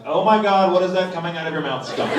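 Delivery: loud, astonished outburst; startled hotel clerk. Retold or imitated. Hotel clerk reacts to his accent, loud mock astonishment. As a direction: imitate a clerk loudly aghast at a voice. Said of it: "And she's like".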